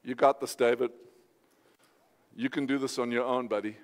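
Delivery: low, soft pep talk to oneself; imagined self-talk. Acted. Preacher voicing what David didn't say to himself, low and soft. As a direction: quietly coach yourself, dropped well down. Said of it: "here's what David didn't say".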